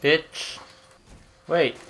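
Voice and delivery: ordinary talk, nothing marked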